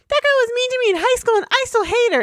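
high, fast mock whine of a grudge; petty grudge-holder. Host mocking people who hold high school grudges, petty high voice. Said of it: "people who just be like".

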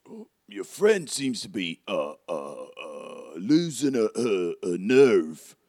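concerned helper, rising into a question; Hagrid. Acted. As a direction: search for a tactful word in a put-on accent. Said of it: "And then he goes".

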